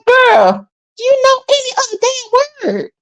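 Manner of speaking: high, fed-up sass; exasperated retort. Retold or imitated. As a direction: roll your eyes at her vocabulary. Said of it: "I'm just like".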